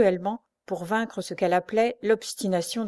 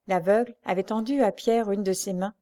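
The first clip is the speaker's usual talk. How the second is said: plain reading voice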